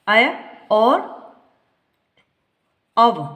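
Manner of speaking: ऐ as "अय" and औ as "अव", each vowel drawn out slowly; Hindi ay and av. Shown as a demonstration. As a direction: say "अय" then "अव" as clear two-part sounds. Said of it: "Hindi में इसका उच्चारण है अय". (अय और अव को अलग-अलग खींचकर बोलें)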